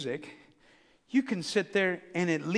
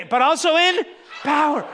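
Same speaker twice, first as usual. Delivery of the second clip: louder, higher, a shouted declaration; said like you mean it; 6 semitones higher, 4 dB louder than usual. Shown as a demonstration. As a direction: belt "But also in power." with full conviction. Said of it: "say it like you mean it".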